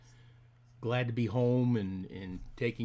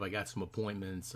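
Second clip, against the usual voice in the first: ordinary delivery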